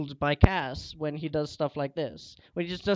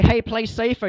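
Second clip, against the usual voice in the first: louder, higher, quoting own warning; loud reenacted warning; 6 semitones higher, 11 dB louder than usual. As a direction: call out the retold advice forcefully. Acted retelling of an argument.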